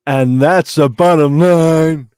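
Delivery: slow, booming catchphrase delivery; wrestling promo. Retold or imitated. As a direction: bellow it like a wrestler on the mic. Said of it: "Oh, some wrestling stuff there".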